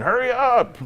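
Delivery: high, put-on hurrying call; imitated impatient call. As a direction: call it out high like a guard rushing someone. Guards hurrying shackled prisoner along.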